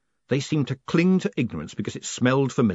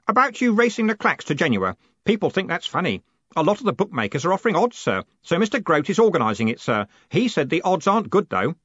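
high, louder, chatty clerk; eager Stanley, happy; 8 semitones higher, 4 dB louder than usual. Acted. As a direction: report the betting cheerfully.

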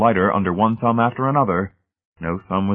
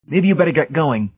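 high, firm send-off; pitched well up, telling him to leave; warning dismissal; 9 semitones higher, 4 dB louder than usual. Acted. Brady sending Hamilton away, insistent.

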